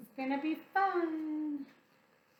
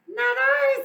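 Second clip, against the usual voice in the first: high, loud cat voice; a prissy high refusal; cat refusing; 12 semitones higher, 8 dB louder than usual. Acted. Cat refusing the hen, high and loud. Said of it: "said the cat".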